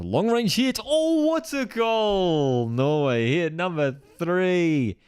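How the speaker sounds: big soaring goal call, drawn out; excited goal call. Real, not acted. Commentator erupting as Norway scores. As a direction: explode as the ball hits the net.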